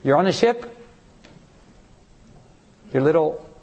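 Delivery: ordinary delivery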